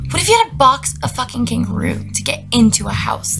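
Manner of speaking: normal talking voice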